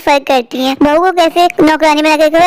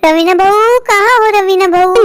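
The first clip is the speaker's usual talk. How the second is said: drawn-out call to the daughter-in-law; 3 semitones higher, slower than usual. Acted. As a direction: call out for her, stretching the words. Calling daughter-in-law from elsewhere. (लंबी आवाज़ में पुकारते हुए)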